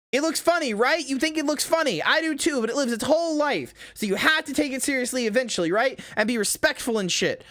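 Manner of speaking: squeaky high-pitched delivery. Shown as a demonstration. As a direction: say it in a high, cutesy voice. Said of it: "repeats it in a lower voice".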